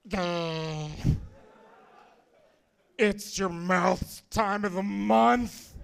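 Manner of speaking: slow, dazed stoner voice; stoned friend. Retold or imitated. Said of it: "didn't know how high Matt was".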